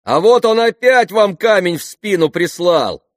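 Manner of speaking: high, loud, drawn-out yells; children shouting. A narrator's reading. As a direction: yell a warning like excited kids. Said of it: "закричали дети". (крики детей)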